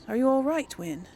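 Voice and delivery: normal talking voice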